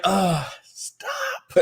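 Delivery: high, laughing self-scolding; mock cringe through laughter. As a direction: wince at yourself mid-laugh. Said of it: "I was like".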